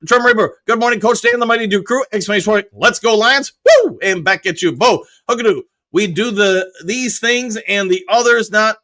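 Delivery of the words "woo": cheer. Real, not acted. Cheering for the Lions.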